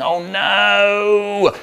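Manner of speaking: high, drawn-out mock alarm; comic dismay. Boy seeing his brother about to be caught, dismayed. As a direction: wail as doom dawns.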